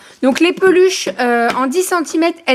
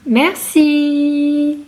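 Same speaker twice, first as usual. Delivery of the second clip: sing-song thanks; 3 semitones higher than usual. Real, not acted. Thanking the baby.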